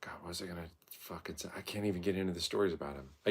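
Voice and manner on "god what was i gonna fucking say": whispering. Real, not acted. Muttering to himself.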